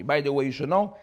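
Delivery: normal talking voice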